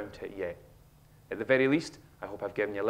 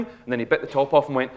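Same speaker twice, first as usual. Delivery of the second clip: normal talking voice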